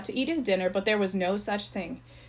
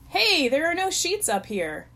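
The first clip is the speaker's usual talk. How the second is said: higher, drawn-out protest; miffed and raised in pitch; indignant complaint; 8 semitones higher, slower than usual. A narrator's reading. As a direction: call out a slow, put-out complaint. Complaint called down from bunk.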